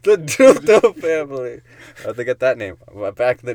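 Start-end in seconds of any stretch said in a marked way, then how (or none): 0.0-1.6 announcer voice. Pretend. Grand announcing of name.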